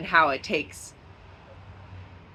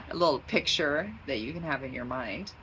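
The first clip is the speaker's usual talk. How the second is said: normal talking voice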